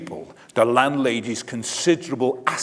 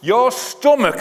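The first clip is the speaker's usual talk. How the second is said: mock scolding wife; 12 semitones higher, 7 dB louder than usual. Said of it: "she said".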